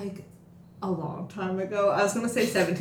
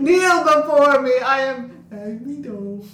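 pompous villain decree, slow and louder; booming arrogant alien overlord; 4 semitones higher, 8 dB louder, slower than usual. Retold or imitated. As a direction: proclaim it like a grand supervillain. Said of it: "He walks in and is like".

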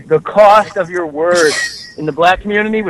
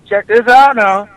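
imitating Nipsey Russell; 6 semitones higher than usual. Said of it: "He goes".

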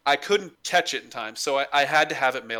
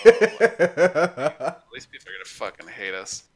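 laughing dread; slower than usual. Real, not acted. Laughing at embarrassing package.

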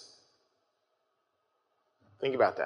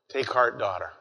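softer, dropping low on daughter; gentle answer; 4 semitones lower, 4 dB quieter than usual. Retold or imitated. Jesus gently answering the healed woman, soft and tender. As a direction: lay Take heart, daughter down gently, falling away. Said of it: "a very gentle answer".

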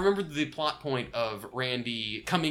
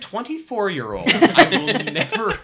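laughing; 9 semitones higher, 6 dB louder, slower than usual. Pretend. Mock prim disclaimer, laughing.

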